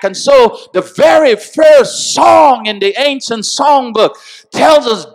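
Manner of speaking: climbing into full-force preaching; rising preacher's shout. Acted.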